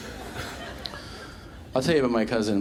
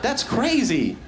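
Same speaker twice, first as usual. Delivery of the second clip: much higher, gushing mock amazement with laughter; amazed engineer; 11 semitones higher than usual. Imagined software engineer gushing over the cousin's idea, much higher. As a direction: gush like a dazzled fan. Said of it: "they would be like".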